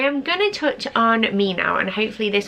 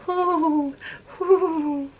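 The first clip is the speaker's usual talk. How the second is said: happy pigeon coo; 8 semitones higher than usual. Retold or imitated. Mum copying her baby's pigeon-like coo. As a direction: coo like a contented baby pigeon. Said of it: "sounds a bit like a happy pigeon".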